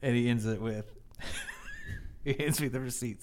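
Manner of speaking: usual speaking voice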